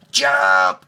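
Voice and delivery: high, loud cry; cried. A narrator's reading. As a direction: shout it joyfully as you leap. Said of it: "he cried".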